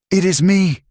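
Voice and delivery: a touch of strangled despair; choked out. A narrator's reading. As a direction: force it out through sobs. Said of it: "she choked out".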